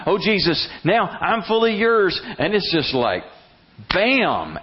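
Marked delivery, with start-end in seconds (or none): 0.1-2.2 higher, slow, starry-eyed vow; mock devoted prayer. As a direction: swoon a little over the vow. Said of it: "mountaintop spiritual experience".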